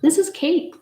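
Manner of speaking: high, loud burst of excitement; excited. Real, not acted. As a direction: announce it with delight. Excited about special occasion cake.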